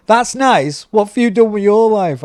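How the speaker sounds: high, loud, offended echo; indignant inner retort. Retold or imitated. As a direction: throw it back in mock outrage. His indignant thought at their reaction.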